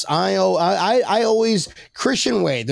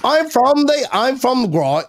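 put-on higher, half-started British accent; mock British accent; 8 semitones higher than usual. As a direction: slip back into the cheesy Brit voice. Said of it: "impressions of people from, from England".

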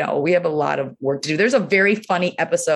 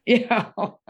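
usual speaking voice

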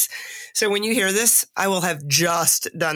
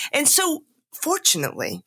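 emphatic fortunately; 8 semitones higher than usual. Real, not acted. Relieved speaker stressing her recovery, pitched up.

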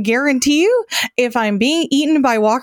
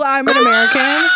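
laughing mock yell. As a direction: yell it like a proud American begging zombies. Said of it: "when he yelled".

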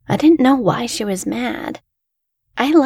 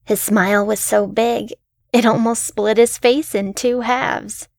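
ordinary delivery

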